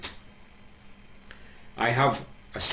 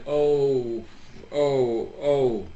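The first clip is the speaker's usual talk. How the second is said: mock sobbing; 3 semitones higher, much slower than usual. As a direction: play the weeping husband, overdone. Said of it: "sobbing and weeping".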